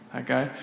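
THAT GUY asked softly as a question. Retold or imitated. Walking back to friends to double-check, questioning THAT GUY. Checking which man was meant.

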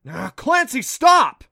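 loud, high forceful shout. Acted. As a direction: yell at her to stop. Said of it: "surprised himself with how forceful he sounded".

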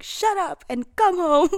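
mock stern dad, cutting her off; dad's snap. Dad telling his drunk daughter to come home, mock stern and loud.

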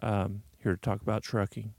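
hushed public radio announcer; public radio host. Pretend. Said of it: "I'm trying to sound like I'm on public radio right now".

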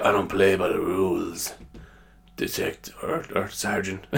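low-key, soft rebel cop voice; quiet TV-cop defiance; maverick cop. Acted. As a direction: play the loose cannon, soft and understated. Maverick cop answering his sergeant.

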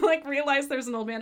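normal talking voice

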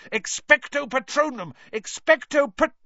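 high, loud desperate incantation; yelled spell. A narrator's reading. Boy casting at the Dementor, yelled spell. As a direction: yell the spell at full force. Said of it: "Harry yelled".

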